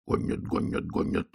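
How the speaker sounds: hushed, breath-only repetition; very soft anxious inner voice; pounding thought. A narrator's reading. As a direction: whisper it like a pulse in the temples. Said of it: "застучало у него в висках".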